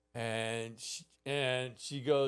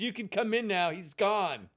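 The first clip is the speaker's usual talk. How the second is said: higher, louder imitated babysitter; calling out; 6 semitones higher, 5 dB louder than usual. Babysitter tells boy the coast is clear, high calling. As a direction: call the child in from the doorway. Babysitter calls child back inside.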